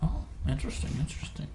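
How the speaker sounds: breathy hushed interest; soft whispered fascination; whispered intrigue. Real, not acted. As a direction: whisper it like a secret.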